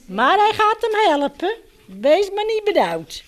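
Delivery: usual speaking voice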